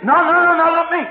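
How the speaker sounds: high, loud, rapid-fire protest; hammed-up panic; panicked denial. Acted Peter denying Jesus.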